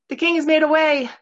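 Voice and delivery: high, loud cry to the crowd; calling out. A narrator's reading. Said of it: "she called out".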